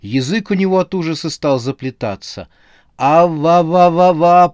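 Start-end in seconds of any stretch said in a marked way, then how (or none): 3.0-4.5 high, loud, slow stuttering babble; tongue tangled with terror; terrified babbling. A narrator's reading. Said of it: "пролепетал он".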